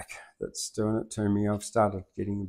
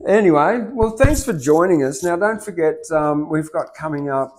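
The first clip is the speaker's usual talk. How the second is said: higher, louder sign-off voice; host outro; 6 semitones higher, 8 dB louder than usual. Acted. Host closing the show for viewers, announcer voice. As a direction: announce the wrap-up brightly.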